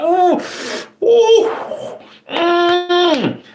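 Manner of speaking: very high, drawn-out "ooh" and "mm"; imitated whimper of delicious pain; spicy-food squeals. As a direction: squeal through a mouth on fire. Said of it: "You know, when you like".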